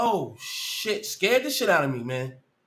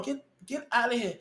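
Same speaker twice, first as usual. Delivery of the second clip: ordinary delivery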